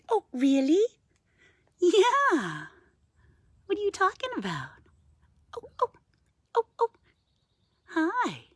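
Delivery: high cooing pet voice; chatting back to a vocal raven in a high voice; pet talk. Real, not acted. Answering the raven's calls.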